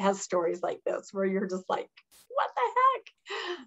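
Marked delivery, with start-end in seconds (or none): 2.3-3.7 high, slow, stretched disbelief; disbelieving. Retold or imitated. Girl reacting to an odd rule, high and incredulous. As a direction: drag it out in mock disbelief.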